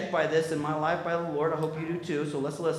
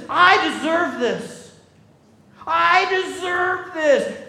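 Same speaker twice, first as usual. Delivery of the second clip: slow, high and louder; drawn-out, self-righteous claim; entitled insistence; 9 semitones higher, 6 dB louder, much slower than usual. Acted. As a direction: hammer home that he has earned it. Entitled older brother's complaint.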